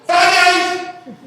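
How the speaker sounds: high, loud shouted answer; imitated yell. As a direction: blurt it out eagerly like a remembering old-timer. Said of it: "they often yell before you finish".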